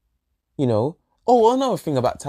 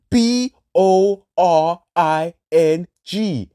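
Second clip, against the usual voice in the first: slow, loud, spelled-out B O R I N G; B O R I N G spelled out slowly and emphatically; spelled out letter by letter; 5 semitones higher, 6 dB louder, much slower than usual. Shown as a demonstration. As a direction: hammer each letter of B O R I N G. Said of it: "With an exclamation mark".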